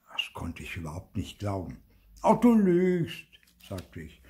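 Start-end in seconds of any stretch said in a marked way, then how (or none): 2.2-3.3 high, loud, drawn-out disbelief; high-pitched indignant cry; loud accusation. A narrator's reading. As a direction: call out the liar like an indignant child. Child accuses sister of lying.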